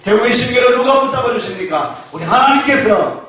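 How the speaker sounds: normal talking voice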